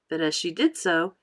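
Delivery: ordinary delivery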